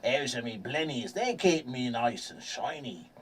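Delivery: slow, drawn-out sea-dog voice; salty sailor. Acted. Sailor explaining the blennies that clean him, slow salty voice. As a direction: take your time like an old pirate.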